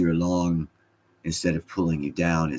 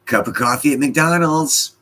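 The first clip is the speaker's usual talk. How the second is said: high, loud comic announcement; mock exclaim; 9 semitones higher, 7 dB louder than usual. Real, not acted. Streamer reading a viewer's joke aloud, comically high and loud. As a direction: blurt it out like a punchline.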